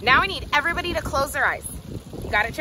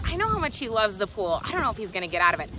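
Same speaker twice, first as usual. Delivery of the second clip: usual speaking voice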